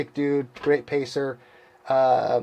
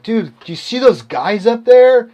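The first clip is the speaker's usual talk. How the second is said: higher, louder, eager alarm; retold amazed question; 7 semitones higher, 8 dB louder than usual. Telling his buddy about hallucinated figures, amazed. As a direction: point something out to a buddy, urgently.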